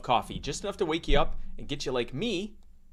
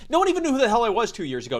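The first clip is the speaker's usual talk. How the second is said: fast hyped brag; 8 semitones higher, much faster than usual. Acted. Hyped boast.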